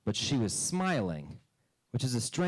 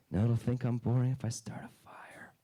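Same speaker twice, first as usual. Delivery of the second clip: put-on whispered child. Child plotting a fire, in a hushed whisper.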